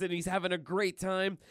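ordinary delivery

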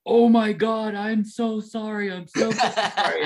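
high, slow, flustered mock apology; apologizing to the stranger he just punched; frantic apology. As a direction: gush out a panicked sorry. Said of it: "I immediately was like".

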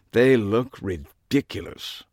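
slow, scornful British verdict; drawn-out British disdain; affected British accent. A narrator's reading. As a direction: pronounce judgment slowly with British affectation. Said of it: "a British accent or a deep-seated affectation".

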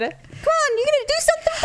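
high mocking dare; gunslinger taunt. Co-host quoting a western taunt, high and mocking. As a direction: goad him like a film outlaw.

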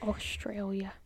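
breathy whisper, no voice; just breathe the word. Real, not acted.

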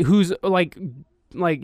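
ordinary talk

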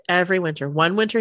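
normal talking voice

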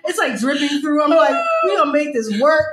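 loud, high, excited; excited shout. Real, not acted. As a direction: shout it with glee.

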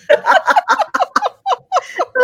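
squealing burst of laughter; high laugh. Real, not acted. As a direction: let out a high helpless laugh.

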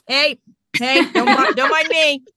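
high, loud, sheepish cover-up; hammed-up embarrassed cheer; mock-breezy public excuse. Greets staring onlookers while dragging son.

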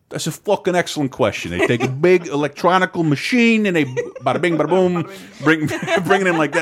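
put-on a lightly done New York accent, laughing; the museum guy in mild New York accent, bada bing bada boom; mild New York guard accent, amused. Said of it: "didn't hear the New York accent".

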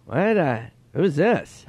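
baffled impression, high and slowed; Norm Macdonald. Comedian not recognising friend's text, imitated puzzlement. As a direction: imitate a clueless comic answering. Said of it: "he'd be like".

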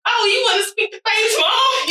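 high-pitched mimicking retort; teasing high mock voice; mocking the caller. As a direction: throw the caller's words back in a high voice. Mocking the caller back in retelling.

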